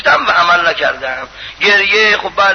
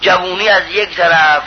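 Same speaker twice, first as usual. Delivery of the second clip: ordinary delivery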